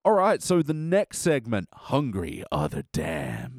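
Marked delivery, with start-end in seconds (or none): 1.7-3.6 low, slow spooky announcer; horror voice. Pretend. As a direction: intone it like a horror host. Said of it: "better to say in a horror voice".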